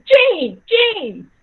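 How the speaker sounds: loud, high-pitched angry call; yelled name. Retold or imitated. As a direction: bellow your wife's name through the house. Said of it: "he yelled out my mama's name".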